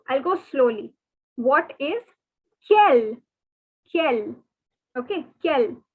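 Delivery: ordinary talk, nothing marked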